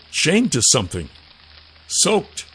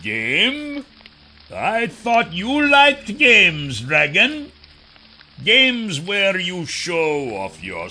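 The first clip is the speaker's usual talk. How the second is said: slow, mocking, higher villain voice; sneering villain; 9 semitones higher, 3 dB louder, much slower than usual. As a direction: drawl out the taunt with contempt. Kryzak taunts the dragon.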